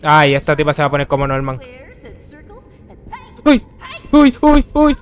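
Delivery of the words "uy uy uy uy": high, loud frightened cries; real scare; panicked yelps. Real, not acted. Player hears the monster coming, yelping in fright.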